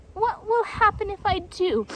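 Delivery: high, sniffly, cautious jaguar; Painted Jaguar. A narrator's reading. Wary jaguar asks the tortoise, high and sniffly. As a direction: ask warily.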